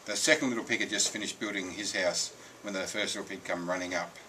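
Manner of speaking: ordinary delivery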